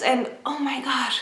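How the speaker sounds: usual speaking voice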